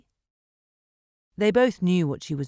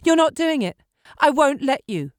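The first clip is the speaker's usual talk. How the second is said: high, loud refusal; desperate shout; 9 semitones higher, 6 dB louder than usual. A narrator's reading. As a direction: yell it to block his way. Meg blocking the door.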